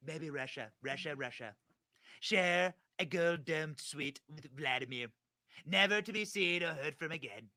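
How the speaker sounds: put-on politician voice, deliberate phrasing; Donald Trump. Host reading a mocking post in the former president's voice. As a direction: read it as a mock presidential impression. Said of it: "I'm gonna read this in Donald Trump voice".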